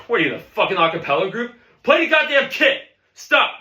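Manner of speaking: loud, high barked orders; enraged bandleader cutting the student off; furious yelling. Acted. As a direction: build from irate jab to full shout.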